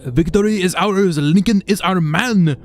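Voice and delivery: mock Japanese. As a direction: crow it like a conqueror. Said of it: "said in Japanese once again".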